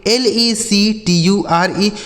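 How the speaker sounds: slow letter-by-letter spelling; each letter given its own beat. Shown as a demonstration. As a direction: spell it out slowly for learners. Spells words out for learners.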